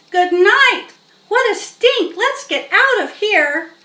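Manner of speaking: loud, high yelling in disgust; shouting. Acted. Boy yelling as he flees the smell. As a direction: shriek to get out of the stink.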